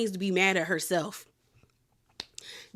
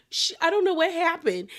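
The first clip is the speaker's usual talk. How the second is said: high, lightly put-on distress; mock tearful venting; 8 semitones higher than usual. Friend venting to best friend's husband, mock tearful. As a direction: imitate an upset caller in a higher voice. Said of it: "she's on the phone crying about it".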